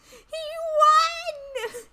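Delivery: high, loud wailing cry; crying shout. Pretend. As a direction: scream it through tears. Climax of mock cry.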